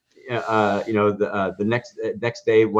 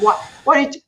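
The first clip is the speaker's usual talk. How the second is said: much higher and louder, tumbling out; excited disbelief; 6 dB louder than usual. Retold or imitated. Reliving the call from the former customer, excited. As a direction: gush it, overwhelmed with excitement.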